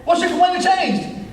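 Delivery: high, insistent question in one run; emphatic pulpit demand; preached challenge. Real, not acted. Same question, now preached high.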